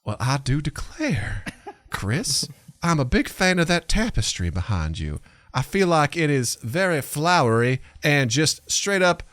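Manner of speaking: sweet-talking Southern belle accent. Shown as a demonstration. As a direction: play a genteel Southern belle. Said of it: "Southern Belle".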